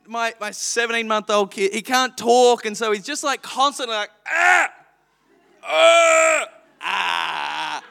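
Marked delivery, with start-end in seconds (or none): 4.3-7.8 put-on toddler wailing. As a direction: mimic a baby who can't talk yet. Toddler Abel making his sound.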